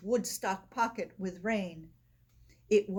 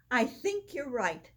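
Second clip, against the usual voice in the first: ordinary delivery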